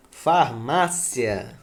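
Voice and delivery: "Farmácia" drawn out slowly; slow model word. Shown as a demonstration. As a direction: stretch out "Farmácia" syllable by syllable. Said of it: "Quando você separa".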